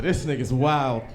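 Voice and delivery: usual speaking voice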